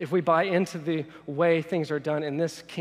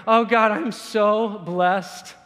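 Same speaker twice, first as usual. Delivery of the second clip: loud, higher, drawn-out thanks to God; self-satisfied gratitude; 5 semitones higher, 9 dB louder, much slower than usual. Acted. Content believer thanking God for the good life, gushing. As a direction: gush it, stretching the words. Acted smug prayer of thanks.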